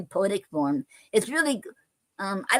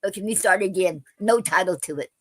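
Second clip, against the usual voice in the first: ordinary delivery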